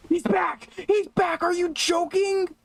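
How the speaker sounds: high-pitched, panicked disbelief; panicked shriek. Real, not acted. Streamer sees the hunter come back, panicked high shriek. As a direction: yelp it out in real panic as the monster returns. Monster returns in horror game.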